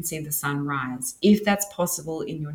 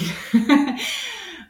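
high-pitched, drawn-out burst of laughter; real high laugh that trails on. Real, not acted. Speaker amused by free natural healing, laughing. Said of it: "I'm just laughing because".

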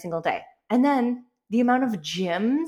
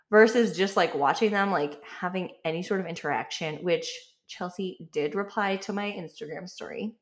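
ordinary delivery

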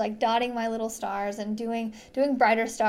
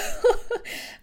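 breathy giggle; 11 semitones higher than usual. Real, not acted. Laughs after sheepish hello.

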